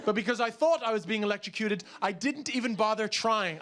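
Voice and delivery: usual speaking voice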